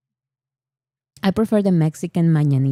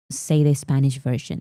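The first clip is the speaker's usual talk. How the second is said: normal talking voice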